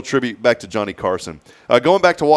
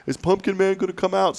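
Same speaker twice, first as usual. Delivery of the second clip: much higher, lightly voiced little girl; 10 semitones higher than usual. Father voicing his little daughter asking about the decoration, high and eager. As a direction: ask like an eager small child. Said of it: "she goes".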